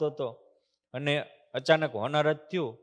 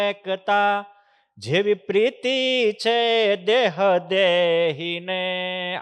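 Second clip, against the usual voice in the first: slow and louder, swelling into the couplet; louder, slow devotional recital; fervent verse recitation; 3 semitones higher, 7 dB louder, slower than usual. Acted. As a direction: preach the verse with rising force, drawn out.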